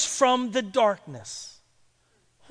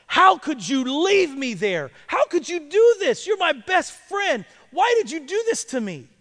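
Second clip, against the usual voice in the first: raised, pained demand to his best friend; hurt anger; 6 semitones higher, 5 dB louder than usual. Retold or imitated. Blind student confronts his friend, hurt, raised voice. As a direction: plead at him, hurt and betrayed.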